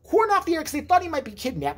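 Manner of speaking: ordinary talk, nothing marked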